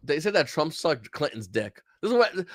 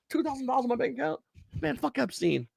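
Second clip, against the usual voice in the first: bought-off citizen; 6 semitones higher than usual. Acted. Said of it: "We're like".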